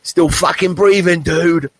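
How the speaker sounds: put-on high and loud old-man shout; loud, high old dude boast; gruff old dude again. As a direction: throw the line out like the old man did. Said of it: "And he said".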